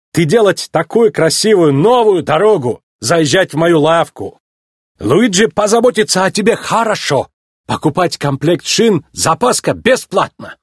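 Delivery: put-on high, animated Italian-accented salesman; Italian tyre seller, eager. Luigi invites the racer into his shop, eager Italian salesman. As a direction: sell it warmly in broken speech with an Italian accent.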